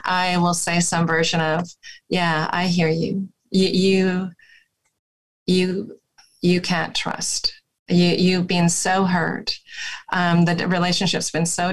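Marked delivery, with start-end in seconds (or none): 2.1-9.6 slow, gentle therapist reflection, lightly done; calm the client with an unhurried tone; slowed soothing voice. Shown as a demonstration. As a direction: therapist soothing an angry partner, slowly. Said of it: "as we use our voices and slow ourselves down".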